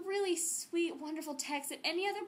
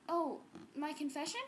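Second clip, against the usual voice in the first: normal talking voice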